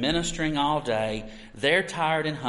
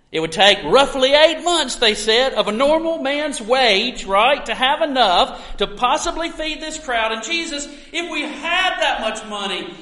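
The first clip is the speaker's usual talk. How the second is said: higher and louder, incredulous; animated retelling; 8 semitones higher, 6 dB louder than usual. Retold or imitated. Preacher voicing disciples counting the cost, high and amazed. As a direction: marvel at the tiny portion. Disciples' calculation voiced excitedly.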